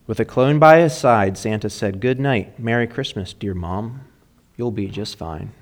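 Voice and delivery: lively storytelling read of a rhyming poem. A narrator's reading. Pastor reads a funny poem to the mothers, playful and rhythmic. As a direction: read the holiday poem to the congregation with a bounce.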